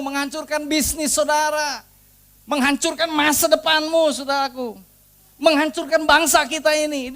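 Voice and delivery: loud, high, full-force exhortation; preacher rousing the church to pray for the nation, loud and high; fiery preaching. Acted. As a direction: rouse the congregation to battle.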